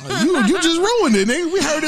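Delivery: laughing. Real, not acted. Teasing friend, laughing.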